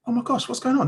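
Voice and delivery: high-pitched exasperated disbelief; incredulous, voice pitched right up; retold disbelief. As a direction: re-enact being aghast at a lie. Retold disbelief at witnesses lying.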